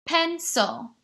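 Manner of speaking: "Pencil" in two beats, PEN high, SIL falling; split into syllables. Shown as a demonstration. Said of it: "count with my other hand".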